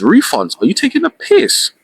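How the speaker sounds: indignant disbelief, pitch leaping high at the end; incredulous. Retold or imitated. Fraud veteran scoffing at a refund pitch, incredulous. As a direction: throw it back in outraged disbelief.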